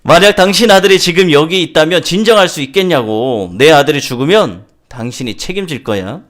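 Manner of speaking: loud, high tirade easing toward the end; angry father. Acted. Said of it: "더욱 화를 내면서 말하기 시작합니다". (화난 아버지가 언성을 높여 따지듯)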